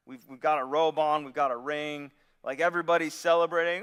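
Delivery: usual speaking voice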